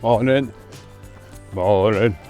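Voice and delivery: loud friendly greeting; calling out. Real, not acted. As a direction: call out hello in passing. Greeting passers-by on the trail.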